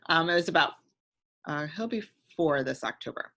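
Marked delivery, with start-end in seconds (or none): none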